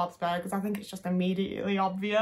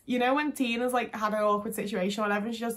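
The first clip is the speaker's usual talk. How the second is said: ordinary talk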